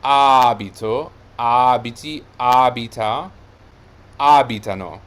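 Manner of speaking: abito, abiti, abita, abitano, each with a loud long AH; stress on first syllable. Shown as a demonstration. Teacher proving the stress pattern to learners, loud first-syllable emphasis. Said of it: "because it is".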